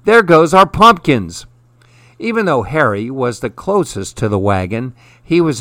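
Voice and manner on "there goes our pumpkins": loud, high exclamation of dismay; alarmed exclamation. A narrator's reading. Harry watches the runaway wagon, alarmed exclamation. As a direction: call it out in alarm. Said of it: "exclaimed Harry".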